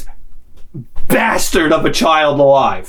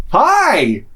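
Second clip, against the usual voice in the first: high, cheery drawn-out greeting; imitated overfriendly hello, pitched up; happy Norwegian brother; 6 semitones higher than usual. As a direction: mimic the girl's equally beaming brother. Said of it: "Another one".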